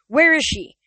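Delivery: loud, high furious demand. A narrator's reading. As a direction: shout the question at him. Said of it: "Nico demanded".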